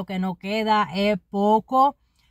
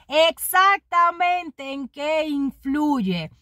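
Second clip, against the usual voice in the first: slow, higher, sneering; sarcastic question; 6 semitones higher, much slower than usual. Acted. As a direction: drag it out with sarcasm. (pregunta sarcástica, lenta y más aguda)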